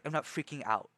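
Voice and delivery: ordinary talk, nothing marked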